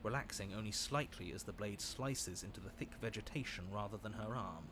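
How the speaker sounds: low, quiet storytelling voice; story narration. A narrator's reading. Narrates the rescue scene.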